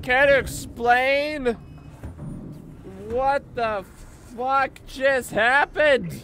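stunned loud exclamation. Real, not acted. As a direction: shout it slowly in disbelief. Stunned by sudden game event.

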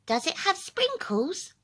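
high, small creature voice; squeaky little critter saying no. A narrator's reading. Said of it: "the little creature asks".

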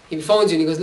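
usual speaking voice

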